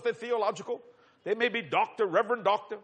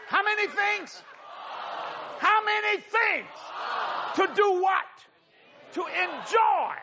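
preaching at full force; 9 semitones higher, slower than usual. Acted. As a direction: shout it out to the congregation.

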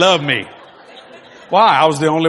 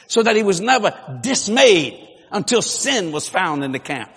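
normal talking voice

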